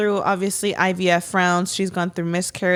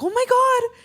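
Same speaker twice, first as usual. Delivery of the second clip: much higher, a squealed oh my God; excited gasp quote. Retold or imitated. Retelling her reaction to Brian, a high excited squeal. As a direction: squeal it like you just saw the news.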